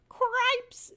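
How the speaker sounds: high, loud panicked yelp; a frantic mouse shrieking; shout. Acted. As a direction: yell it out in alarm. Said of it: "shouted Furlow again".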